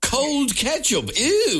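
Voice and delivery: high, drawn-out revulsion; theatrically grossed out; exaggerated disgust. Real, not acted. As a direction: recoil from it with a long, high "ew". Mock revulsion at chilled ketchup.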